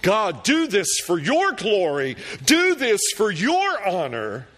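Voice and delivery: a bold appeal lifted high; fervent plea. Acted. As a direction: press God for his glory. Paraphrasing the prayer.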